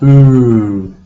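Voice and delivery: "Ooh" held long and steady; long vowel sound. Shown as a demonstration. Teacher isolating the vowel in queue, held long. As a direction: draw out Ooh as one pure vowel. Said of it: "It's long".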